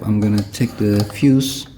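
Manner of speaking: normal talking voice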